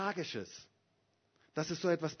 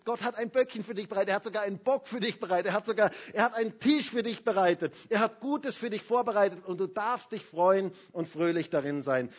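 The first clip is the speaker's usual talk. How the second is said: louder, higher pleading sermon; impassioned pastor, louder and higher; fervent preaching; 3 semitones higher, 6 dB louder than usual. Acted. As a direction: preach it out to the congregation. Preaching at full force.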